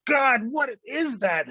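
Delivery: yelped high and loud on Oh, God, then dropping; a sudden shriek of pain and disgust; wife stepping on a toenail, high startled yelp. Retold or imitated. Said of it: "She'd go".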